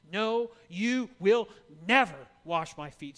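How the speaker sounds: loud, leaning hard on never; flat-out refuse him; emphatic refusal. Retold or imitated. Peter loudly refusing to let Jesus wash his feet. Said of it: "in the strongest language possible".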